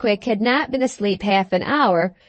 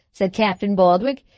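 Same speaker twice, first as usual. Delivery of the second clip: ordinary delivery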